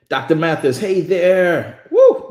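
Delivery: loud, high, hyped-up welcome; cheering the guest on with a loud woo; excited hype. Real, not acted. Hyping up the guest speaker.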